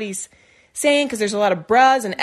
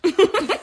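high laugh; 9 semitones higher, 3 dB louder than usual. Real, not acted. Laughing at her own story.

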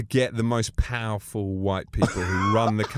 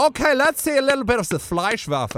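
high-pitched camp Austrian wheedling; 9 semitones higher than usual. Retold or imitated. Bruno coaxing the wrestlers, high camp voice.

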